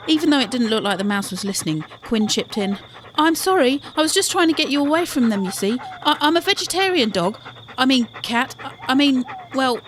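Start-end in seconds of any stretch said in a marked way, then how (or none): 3.2-9.8 high, loud, hurried stammering apology; flustered apology. A narrator's reading. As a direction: blurt out a muddled excuse, pitched up. Quinn flustered, apologising to the mouse.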